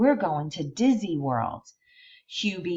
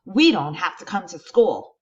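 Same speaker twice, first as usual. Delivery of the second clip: shouting; 3 semitones higher, 8 dB louder than usual. Acted. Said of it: "shouts out Eric".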